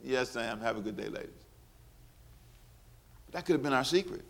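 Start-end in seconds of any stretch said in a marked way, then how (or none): 0.0-1.3 soft, lower, curt politeness; understated polite refusal; prim polite brush-off. Retold or imitated. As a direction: dismiss them quietly and primly. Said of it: "I went".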